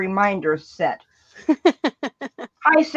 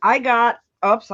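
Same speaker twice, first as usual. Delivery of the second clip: ordinary delivery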